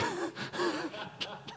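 sudden high-pitched giggle; a squeal of laughter at himself; high squeaky giggle. Real, not acted. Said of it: "I feel like the weatherman".

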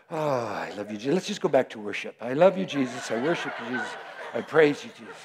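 low, soft prayer with light laughs; laughing worship prayer. Real, not acted. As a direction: pray softly, amused at yourself.

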